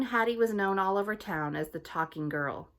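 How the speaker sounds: plain reading voice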